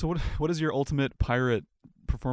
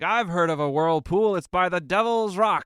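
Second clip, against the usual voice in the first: put-on drunk pirate voice; 7 semitones higher, 6 dB louder than usual. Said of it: "another pirate leans in, stumbling over".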